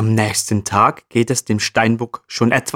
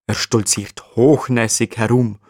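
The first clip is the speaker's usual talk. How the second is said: ordinary delivery, nothing marked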